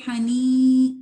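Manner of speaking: "حنی" loud with a nasalised "نی"; wrong nasal nee. Shown as a demonstration. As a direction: demo the mistake: nose into the "نی". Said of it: "نی نہیں کہنا". (غلط غنہ والا "حنی")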